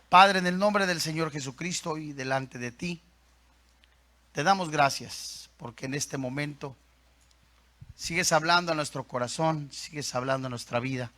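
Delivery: low, solemn prayer voice; congregational prayer. Real, not acted. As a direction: address the Father with measured pauses.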